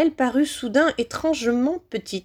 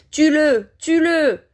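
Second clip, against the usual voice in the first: high, loud repeated kill order; yelping cries; 7 semitones higher, 5 dB louder, slower than usual. A narrator's reading. Villain orders the boy killed, yelping. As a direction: yelp the order twice, frantic. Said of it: "les glapissements de Voldemort qui répétait".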